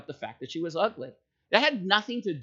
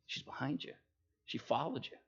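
hushed, urgent whisper; whispered warning; 4 semitones lower, 11 dB quieter than usual. Retold or imitated. Boss whispering to the grocer that the customer is right behind him. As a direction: tip him off under your breath.

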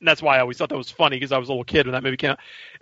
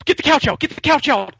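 high, frantic repeated order; urgent panicked hurry; frantic excited shout; 10 semitones higher than usual. Retold or imitated. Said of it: "And she starts, like".

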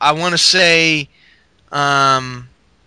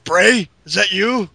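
spooky call. Pretend. Calling out for Bray.

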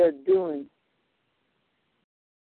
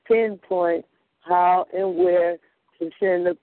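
ordinary delivery

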